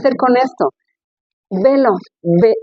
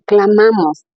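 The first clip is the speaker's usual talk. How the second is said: usual speaking voice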